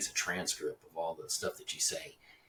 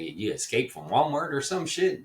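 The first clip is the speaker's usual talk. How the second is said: higher, louder, slow mocking question; mock disbelief; 8 semitones higher, 6 dB louder, slower than usual. Real, not acted. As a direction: sneer in disbelief at her outfit. Mocking a stranger's outfit.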